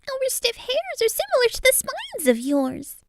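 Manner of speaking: very high, squeaky mouse voice; tiny high-pitched rodent; timid mouse. A narrator's reading. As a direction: squeak as a mouse wanting to hide. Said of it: "The mouse wanted to hide and said".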